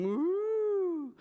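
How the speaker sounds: long moo, pitched high. A narrator's reading. Storyteller showing children the cow's call, high mooing. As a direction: swell up into a drawn-out moo. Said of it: "The cow was mooing".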